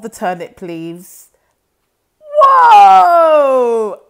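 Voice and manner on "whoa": a big, loud cry of falling; loud whoa. Acted. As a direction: topple with a shout. They all fall over.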